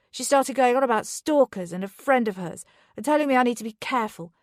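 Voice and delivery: rising fury, fast and loud; angry rant. Acted. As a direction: let it pour out, heated. Drunken quarrel with Charlie.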